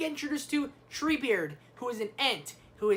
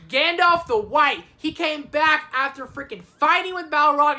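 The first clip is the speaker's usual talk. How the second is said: loud, high, excited announcement; hyped reveal; 5 semitones higher, 8 dB louder than usual. Real, not acted. Reviewer revealing Gandalf's return to viewers, hyped and loud. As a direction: reveal it like a huge comeback.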